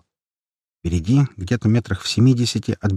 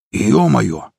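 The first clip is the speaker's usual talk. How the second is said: mildly astonished exclamation; surprised exclamation. A narrator's reading. Fighter watching the brawl, surprised. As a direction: let out a brief cry of surprise. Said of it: "удивлённо воскликнул один из бойцов".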